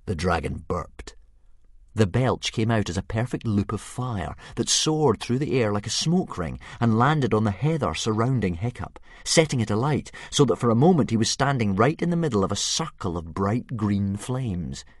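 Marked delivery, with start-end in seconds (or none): none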